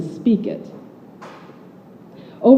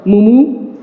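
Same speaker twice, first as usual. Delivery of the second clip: a louder mock Nigerian "Mumu"; Nigerian-accented insult; 3 semitones higher, 4 dB louder than usual. Yelling at crazy drivers, light Nigerian accent. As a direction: toss off the Nigerian insult with a grin. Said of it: "calling the crazy drivers around me".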